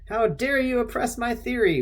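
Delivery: high-pitched indignant protest; mock outrage. Crank scolding a doubter over his theory, mock outrage. Acting out Ray's argument with a challenger.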